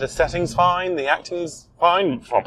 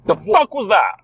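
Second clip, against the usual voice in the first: startled, higher-pitched outburst; jolted by a sudden loud bang; real startled alarm; 5 semitones higher, 4 dB louder than usual. Real, not acted. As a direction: blurt it out in genuine shock. Stone suddenly hits the car.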